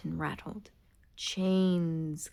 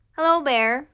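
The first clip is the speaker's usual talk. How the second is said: cheery greeting; 7 semitones higher, 11 dB louder than usual. Acted.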